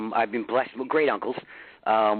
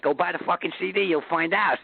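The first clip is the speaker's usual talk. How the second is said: put-on a brash New York accent, pitched up a touch; 7 semitones higher than usual. Rock singer telling fans to buy the CD, in a New York accent. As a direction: snap it out like a New Yorker brushing off fans. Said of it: "I love the accent".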